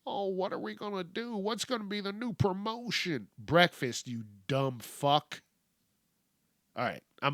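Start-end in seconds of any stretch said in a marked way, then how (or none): 0.1-3.3 higher and softer, a fretful mock of the companies; mocking whine. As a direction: mimic their panicked whining, higher and quieter. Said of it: "hearing these companies bitch".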